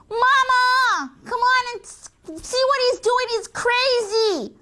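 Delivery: high, drawn-out tattling cry; calling for Mom. Acted. Sister calling Mama to see crazy brother, alarmed. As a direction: call out urgently, high and stretched. Sister calls mother about baby.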